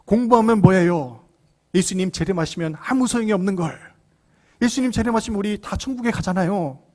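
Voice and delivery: high-pitched, protesting retort; son talking back. Retold or imitated. Son answering his scolding mother, defiantly. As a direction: act out a defiant boy arguing back. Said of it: "그 어머니에게 이렇게 대답하는 거예요".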